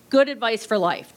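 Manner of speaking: usual speaking voice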